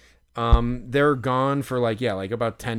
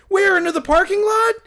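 much higher, loud, crying-out shock; stunned disbelief; 6 dB louder than usual. Retold or imitated. Fan told the player just left, shocked yelling. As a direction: yelp it like the news just hit you.

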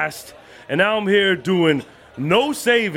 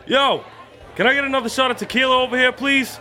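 calling out; 5 semitones higher, much faster than usual. Acted. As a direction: shout across the bar, higher. Calling out to bartender.

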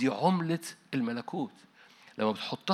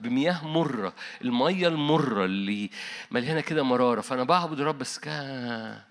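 preaching at full force; 4 dB louder than usual. Acted. Preacher rousing the congregation, loud and fervent. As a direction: build the sermon to a rousing peak.

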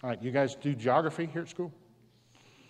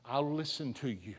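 desperate prayer; 3 semitones higher, 8 dB quieter than usual. Retold or imitated. Father underwater pleads with God, hushed prayer. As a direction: beg God under your breath. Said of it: "he was praying".

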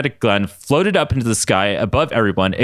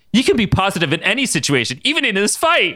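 excited; 9 semitones higher than usual. Acted. Ryan's cheerful quoted line.

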